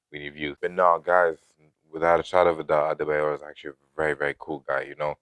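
ordinary talk

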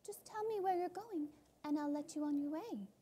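bright high bargaining voice; high girl voice. Acted. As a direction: offer it lightly, high. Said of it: "replies Ludmilla".

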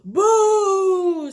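loud, high, long-held boo; big spooky boo. Acted. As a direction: boo with full force to scare. Jack-o'-lantern repeats its scare.

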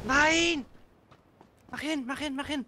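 urgent high panicked yelling; being chased. Real, not acted. Chased by enemies, urging teammate.

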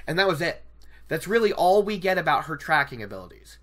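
ordinary talk, nothing marked

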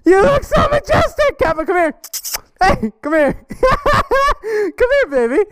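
high cooing voice with laughs; calling the dog over in a high sweet voice. Real, not acted. Talking to the dog.

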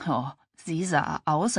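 ordinary delivery

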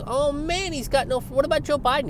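high sarcastic exclamation. Real, not acted.

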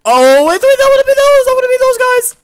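very high, loud shriek; excited squeal. Real, not acted. Gamer shrieking with excitement at the screen. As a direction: squeal with sudden delight.

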